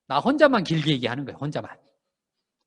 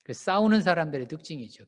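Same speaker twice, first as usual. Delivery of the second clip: normal talking voice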